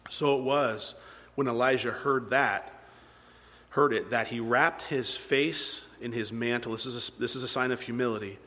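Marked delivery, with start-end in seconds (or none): none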